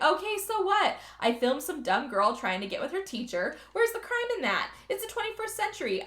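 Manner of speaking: high-pitched snarky comeback; imitated unrepentant sass; mock defiance. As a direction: play a defiant girl brushing off blame. Acting out girl's defiant reply.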